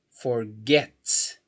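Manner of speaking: forget with GET punched; stress on the final syllable. Shown as a demonstration. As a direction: lean hard on the "get" in forget. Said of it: "é a sílaba final".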